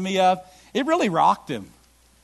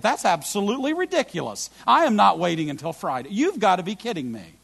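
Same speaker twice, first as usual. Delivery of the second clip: mock impatient customer; 5 semitones higher, 5 dB louder than usual. Mocking impatient Prime customer.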